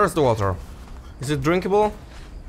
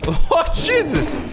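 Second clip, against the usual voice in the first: high, drawn-out startled exclamation; shocked cry; 14 semitones higher, slower than usual. Real, not acted. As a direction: cry out at sudden gore. Shocked by blood in fight.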